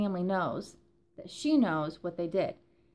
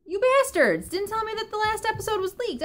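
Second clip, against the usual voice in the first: loud, high scolding mom voice; 12 semitones higher, 7 dB louder than usual. Acted. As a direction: scold them like an upset mom. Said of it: "my mom voice is out because I'm upset".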